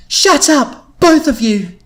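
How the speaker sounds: high, loud narrated shout. A narrator's reading. Louise breaking up her arguing friends, shouting. Intervening in the argument, read aloud.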